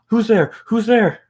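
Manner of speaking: high, loud fearful call; frightened woman. Acted. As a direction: ask it in panic, voice up high.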